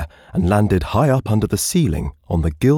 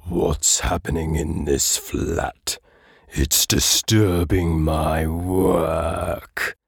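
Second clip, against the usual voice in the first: slow, rumbling voice from nowhere; slow rumble of an unseen grumbler; low rumbling voice; much slower than usual. Acted. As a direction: rumble it slowly as if from thin air. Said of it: "a low, rumbling voice that seemed to come from nowhere".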